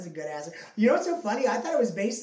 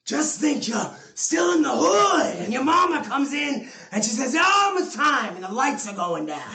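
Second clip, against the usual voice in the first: Al Pacino yell; 6 semitones higher, 6 dB louder than usual. Retold or imitated. To his cohost, retelling a Pacino movie speech, shouting. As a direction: belt it out like Pacino's locker room speech. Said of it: "and he goes".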